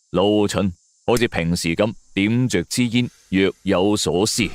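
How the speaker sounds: ordinary delivery, nothing marked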